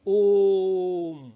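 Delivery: ओम chanted long and steady; ओम को तीन गुना लंबा खींचो; prolonged om. Shown as a demonstration. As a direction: hold the ओम for three beats, like a chant. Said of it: "तीन गुना अधिक समय".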